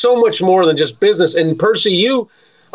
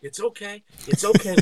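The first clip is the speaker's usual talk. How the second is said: hushed, soothing imitation; softly calm someone down, as a retelling; Percy reassuring; 9 dB quieter than usual. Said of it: "and you're like".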